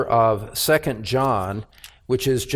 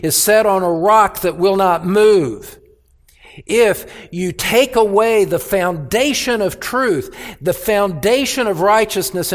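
louder, higher, insistent proclamation; full-force preaching; 5 semitones higher, 3 dB louder than usual. Acted. Pastor warning the church against compromising truth, full force. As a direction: pound each clause like a sermon climax.

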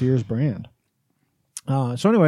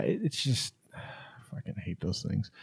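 exasperated whisper; 6 semitones lower, 15 dB quieter than usual. Real, not acted. Exasperated aside about receipts.

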